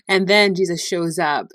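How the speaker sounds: normal talking voice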